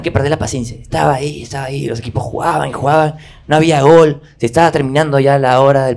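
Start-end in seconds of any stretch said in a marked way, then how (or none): none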